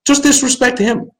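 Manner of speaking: rallying jab at the president; emphatic. Real, not acted. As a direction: say "Just" with a high push. Ranting advice to players.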